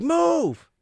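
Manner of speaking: high, loud mock yell; sharp high holler; impatient yell. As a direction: imitate a driver yelling at pedestrians. Angry driver yells at pedestrians.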